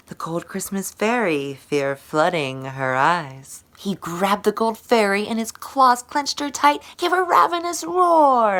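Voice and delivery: usual speaking voice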